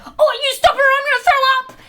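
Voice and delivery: high, loud panicked yell; panicked shout. Retold or imitated. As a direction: shriek it in a panic. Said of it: "I was like".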